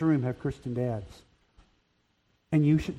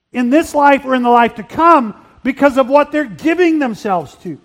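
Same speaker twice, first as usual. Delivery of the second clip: full-force preaching; 10 semitones higher, 10 dB louder than usual. Acted. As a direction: preach it hard to the fathers.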